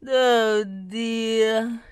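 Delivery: loud, dismayed sigh; weary oh dear. A narrator's reading. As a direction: sigh out worried. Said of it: "he sighed".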